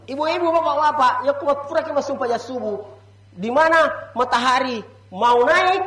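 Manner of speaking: ordinary talk, nothing marked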